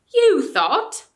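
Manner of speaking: loud, high mocking echo; scornful echo. Ape mocking the bear, loud and high. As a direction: throw the word back with scorn. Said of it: "repeated the ape".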